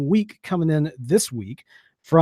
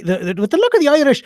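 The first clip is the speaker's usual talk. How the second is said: higher and louder in a playful mock Irish brogue; Irish accent; 6 semitones higher, 4 dB louder than usual. Co-host playing to Irish listeners, mock Irish accent. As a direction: toss in a jokey Irish lilt on luck of the Irish. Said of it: "that was a good accent".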